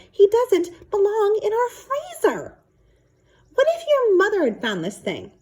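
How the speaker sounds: a dad losing patience; exasperated father. Acted. Father scolds about freezer.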